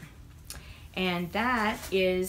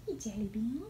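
soft pet voice; 7 dB quieter than usual. Real, not acted. Greeting the cat softly.

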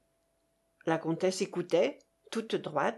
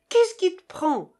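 high, loud indignant question; exclaimed protest; 9 semitones higher, 6 dB louder than usual. A narrator's reading. Russian challenging his generous partner, exclaiming. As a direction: cry out in exasperated disbelief. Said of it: "s'écria le Russe".

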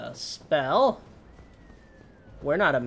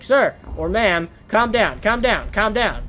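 louder, higher insistent calm down; yelling customer support; 7 semitones higher, 7 dB louder, slower than usual. Shown as a demonstration. Showing how to yell at a customer, louder and higher. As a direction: yell it over and over to soothe. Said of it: "yell at them".

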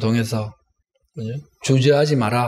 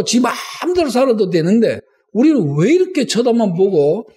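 high, insistent protest, acting out the prophet; 따지는 기도; 8 semitones higher than usual. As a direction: pour out indignant questions to God at a high pitch. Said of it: "제가 앞에 말한 이것을 따지는 겁니다". (선지자처럼 높은 목소리로 격하게 따지듯)

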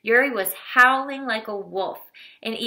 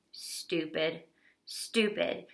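grim mutter; 3 semitones lower, 10 dB quieter, much slower than usual. A narrator's reading. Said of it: "shook his head grimly".

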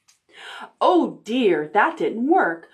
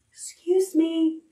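high, slow prim excuse me; mock polite; 10 semitones higher, slower than usual. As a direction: put on a dainty apology. Mock politeness after burp.

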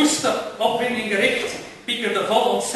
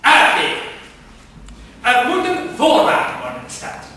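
pompous ranting; 4 semitones higher, 6 dB louder than usual. Acted. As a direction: lay down the law to nobody. Man rants about his rights.

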